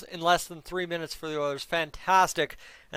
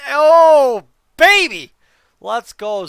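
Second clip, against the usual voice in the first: ecstatic roar, much higher and louder; goal celebration; 8 semitones higher, 15 dB louder, much slower than usual. Real, not acted. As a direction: let it rip like the puck just went in.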